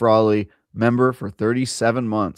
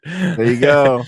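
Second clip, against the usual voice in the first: put-on louder Southern UK accent; 5 semitones higher, 9 dB louder, slower than usual. Co-host answering in a Southern UK accent. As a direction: play it as a Southern English bloke. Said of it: "you did Fat Boy Horror Southern".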